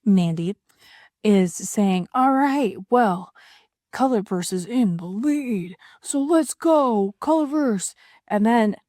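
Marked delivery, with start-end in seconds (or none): 2.2-7.9 mock sports commentator. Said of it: "as if the commentator, Mandy, is saying".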